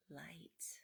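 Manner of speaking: a soft, breathless whispered word; awed whisper. Acted. Mouse seeing light ahead, a soft awed whisper. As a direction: breathe it out in wonder.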